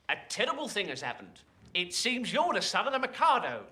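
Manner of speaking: put-on lowly tailor speaking with a broad Cockney accent. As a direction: play it as a cheap London Cockney tailor. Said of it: "You've become a cockney".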